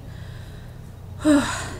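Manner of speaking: real winded sigh, airy and voiceless; out-of-breath sigh. Real, not acted. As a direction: let out a long puff of breath, winded. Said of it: "Sorry, I'm out of breath".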